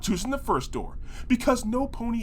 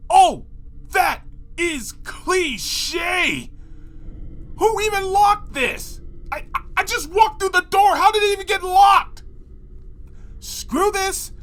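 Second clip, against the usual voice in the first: much higher, loud indignant yelling; outraged shout; 11 semitones higher, 4 dB louder than usual. Acted. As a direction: rant at the locked door in disbelief. Outraged at locked door.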